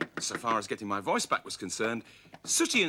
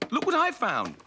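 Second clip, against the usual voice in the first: much higher eager puppet voice; high Scampy voice; 10 semitones higher, 3 dB louder than usual. A narrator's reading. Scampy showing off a box of lozenges, high and eager. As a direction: pitch it up, proud of the find. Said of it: "said Scampy".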